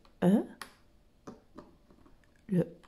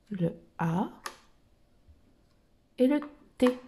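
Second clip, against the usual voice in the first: ordinary delivery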